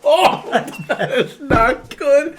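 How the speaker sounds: slow, high, laughing groan; disgusted laugh. Real, not acted. Reacting to awful drink taste.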